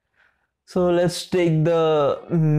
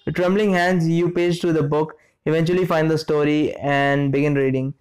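usual speaking voice

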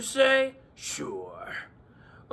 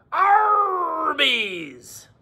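pirate voice; 9 semitones higher than usual. Acted. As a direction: shout the "Ar" like a pirate. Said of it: "my pirate voice".

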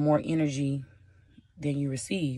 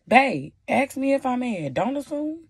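much higher, pleading exasperation; exasperated plea; 9 semitones higher, 3 dB louder than usual. Retold or imitated. Girlfriend begs her partner to ask, not assume, high and exasperated. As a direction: raise it high, begging them to just ask.

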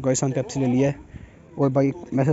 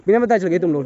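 louder, higher-pitched accusation; heated scolding; 8 semitones higher, 5 dB louder than usual. Real, not acted. Vlogger scolding companions who left without telling, heated. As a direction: argue back, cutting off denials.

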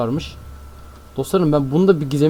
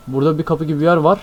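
usual speaking voice

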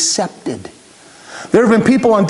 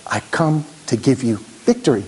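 word-by-word emphasis with pauses; measured, unhurried declaration of "victory"; God emphasizing each word; emphatic, deliberate; 8 semitones lower, 5 dB quieter than usual. Acted. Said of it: "It's almost like God is emphasizing".

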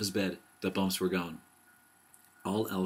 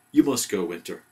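brief storybook shout, easing at the end; shouted order; 8 dB louder than usual. A narrator's reading. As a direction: order the guest out, loud at first. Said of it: "shouted Owl".

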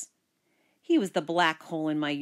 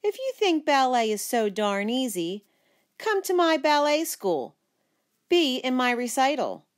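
higher, mock-sweet goading; pitched-up, taunting invitation; cooing challenge; 6 semitones higher, 3 dB louder than usual. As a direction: bait your brother with a coo. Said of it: "I cooed".

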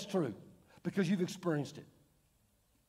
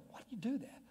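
whisper; 3 semitones higher, 12 dB quieter than usual. Retold or imitated. Mock rude waiter, voice drops.

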